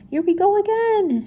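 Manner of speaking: Shaggy wailing at the ghost; frightened wail. Acted. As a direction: wail it in cartoon panic. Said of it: "Shaggy moaned".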